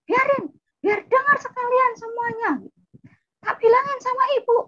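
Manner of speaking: very high-pitched angry shriek, imitating older sibling; defiant yelling. Older sibling threatens to tell mother, high defiant yelling. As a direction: yell it for all to hear. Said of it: "nggak usah teriak-teriak".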